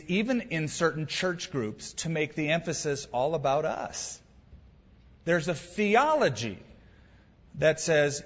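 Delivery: usual speaking voice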